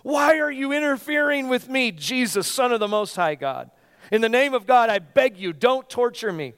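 high, loud pleading cry; a desperate possessed man screaming at Jesus; demon's scream. Acted. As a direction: shriek it out like a tormented demon. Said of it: "he screamed".

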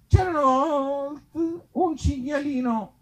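drawn-out once-upon-a-time; storybook voice. Acted. Comic opens the boar story slow and high. Another fairy-tale opening.

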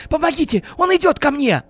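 high, loud cry for help; panicked squealing. A narrator's reading. Businessman begs the guards for help, squealing in panic. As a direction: shriek as if cornered. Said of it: "вдруг завизжал Леонид Владимирович".

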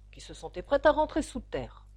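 ordinary delivery, nothing marked

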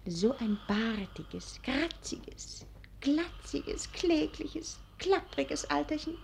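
soft, slow, half-whispered teasing; mock him under your breath so he won't wake; hushed mockery. Young wife jeering softly over her snoring old husband, hushed.

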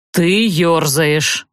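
very slow, low-pitched rebuke; restrained male character. A narrator's reading. Rider rebukes his fidgeting companion.

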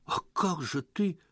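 high, incredulous question; stunned question. A narrator's reading. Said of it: "ошеломлённо перебил Лёха".

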